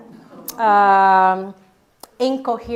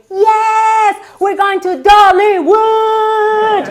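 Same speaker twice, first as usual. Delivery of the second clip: high, loud sing-song shout of joy; slow, high singing cheer; thrilled shout; 10 semitones higher, 9 dB louder, much slower than usual. Retold or imitated. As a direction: belt it out like a kid going to a theme park. Overjoyed answer to the judge.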